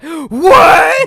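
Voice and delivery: very loud, high yell of disbelief; shocked shout. Retold or imitated. Bard handed a battle axe, shouting in shock. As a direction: blurt it out, utterly aghast.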